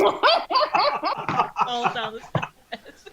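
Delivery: high, loud, helpless laughter through the words; laughing. Real, not acted. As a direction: say it while cracking up. Table cracks up at drunken stumble.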